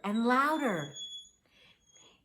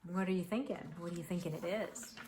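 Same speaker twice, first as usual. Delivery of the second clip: ordinary delivery, nothing marked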